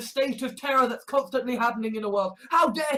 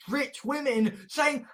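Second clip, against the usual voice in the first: ordinary talk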